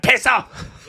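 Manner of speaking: high yelled "Piss off!"; mock shout. Retelling Elizabeth shouting at mum.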